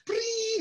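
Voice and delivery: high squeaky ring noise; mock ringtone sound; imitated ringtone. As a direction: chirp it like an old phone ringing. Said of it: "me sonaba, por ejemplo".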